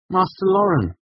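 high, loud, desperate appeal; pleading. A narrator's reading. Student begging the master, high and pleading. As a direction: cry out to him for mercy. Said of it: "I pleaded".

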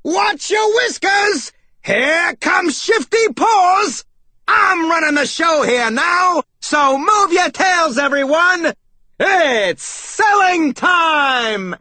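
high, slow, drawn-out holler; big cartoon salesmouse battle cry; triumphant yell. A narrator's reading. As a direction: yell it like a brash showman taking over. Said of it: "I heard him yell".

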